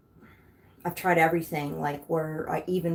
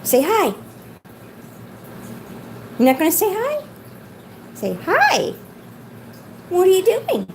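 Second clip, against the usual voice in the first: coaxing pet talk; 11 semitones higher than usual. Real, not acted. Owner asking the bird to say hi, coaxing. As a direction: prompt the bird brightly in a high voice.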